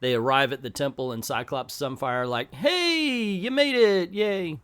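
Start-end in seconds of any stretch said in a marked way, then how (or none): 2.5-4.6 high, drawn-out greeting; hammed-up welcome, starting high and easing down; mock cheer. Cyclops and Sunfire greet arriving teammates, mock-cheerful.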